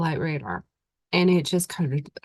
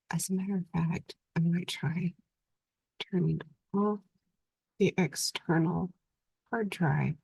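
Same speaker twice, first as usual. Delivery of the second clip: whispering; 7 dB quieter than usual. Real, not acted. Muses quietly about the hard drive.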